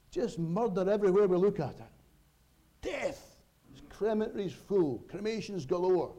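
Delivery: high, forceful pulpit outcry; impassioned preaching. Real, not acted. Preaches on death everywhere.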